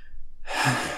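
long, voiceless weary sigh; heavy exhaled sigh. A narrator's reading. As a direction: let out a heavy breath. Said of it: "He sighed heavily".

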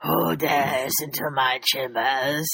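low slow villain voice, repeated on request; dragon voice. Pretend. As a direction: rumble it like a dragon in its lair. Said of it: "can you do that again?".

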